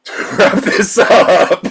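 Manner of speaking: slow, high words breaking into laughter; can barely get it out for laughing; laughing through it. Real, not acted. [name] laughing while trying to wrap up the episode.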